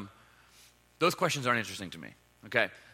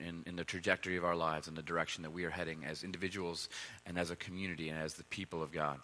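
low, hushed praying voice, sinking softer; prayer; 9 semitones lower, 5 dB quieter than usual. Real, not acted. Pastor leading the congregation in prayer before the reading, hushed prayer. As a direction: pray low and quietly, unhurried. Let's pray.

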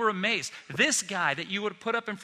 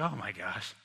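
hushed awe; 8 semitones lower, 7 dB quieter than usual. Retold or imitated. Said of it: "They're like".

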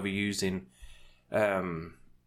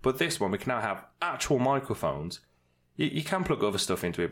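normal talking voice

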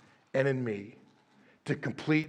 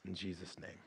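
low, soft, unhurried prayer voice; closing prayer; 5 semitones lower, 3 dB quieter than usual. Acted. Pastor closes the sermon praying over the congregation, hushed and low. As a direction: pray it quietly, with long pauses.

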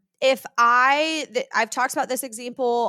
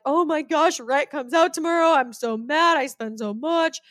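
mock grumbling; 3 semitones higher than usual. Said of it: "That was my mentality".

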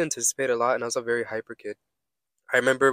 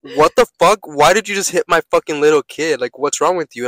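angry parent; 4 semitones higher, 11 dB louder than usual. Retold or imitated. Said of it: "he's like".

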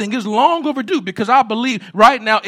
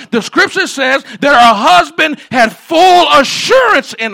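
loud, rising preacher's call to the congregation; preaching at full force; 4 semitones higher, 5 dB louder than usual. Acted. Preacher urging the church to honor working mamas, loud and rising. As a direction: build the point hard and loud, like a Sunday pulpit.

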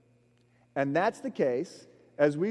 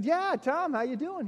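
cheery greeting; 12 semitones higher than usual. Retold or imitated. Cheerful greeting to old roommate.

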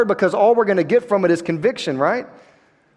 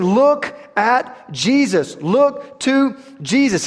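drawn-out "Look at Jesus", very slow; slow emphatic; 4 semitones higher, much slower than usual. Acted. Preacher urging his church to look to Jesus, slow. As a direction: lean on every word, slowly. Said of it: "He's saying".

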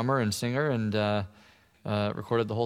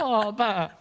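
high, mock Swedish [name]; Swedish accent; 10 semitones higher than usual. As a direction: lilt it like a Swede. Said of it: "We're practically Swedish".

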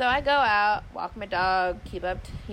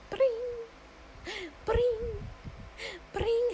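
high, cheery phone ringing; imitated phone ring; slower than usual. Storyteller imitates the phone ringing like normal, cheery trill. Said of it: "that phone started ringing like normal".

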